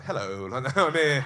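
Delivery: cheeky voice popping out to say hello. Retold or imitated. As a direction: pop up like a perky little character. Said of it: "he was like".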